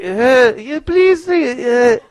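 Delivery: high, feeble begging voice; pleading worshipper. Acted. Someone praying to God for help, high pleading. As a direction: squeak out a hesitant plea.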